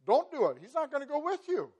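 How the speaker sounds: urgent warning shout. Retold or imitated. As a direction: warn them off loudly, high and urgent. Moses warns the people.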